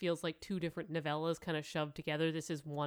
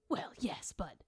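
hushed aside before the reply; whisper; 4 dB quieter, slower than usual. Acted. Granny hushes before answering Nanny, whispered. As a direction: drop to a whisper, then carry on. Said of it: "unvoiced whisper in the read".

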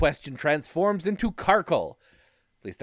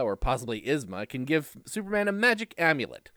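normal talking voice

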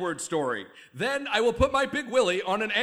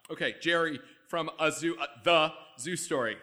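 ordinary talk, nothing marked

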